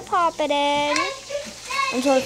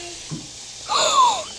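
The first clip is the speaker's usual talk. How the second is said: high surprised gasp. Pretend. Stunned by the trade offer.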